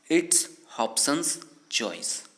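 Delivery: very slow, word by word, spelled out for learners; slow teaching read. Shown as a demonstration. English teacher dictates the sentence slowly to learners. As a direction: stretch each word like dictation. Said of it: "कैसे कहते हैं".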